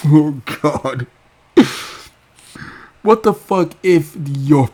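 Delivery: high, loud mock rant breaking into laughter; imitating Raven, laughing. Raven mocked, losing it and laughing mid-rant. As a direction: try to stay in the tantrum while cracking up. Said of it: "she will take it out on the producers".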